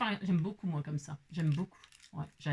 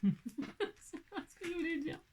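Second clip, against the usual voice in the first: giggling through it, higher; laughing; 6 semitones higher, 6 dB quieter than usual. Real, not acted. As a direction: laugh at yourself while saying it. Laughing at losing her thought. (en riant, plus aigu)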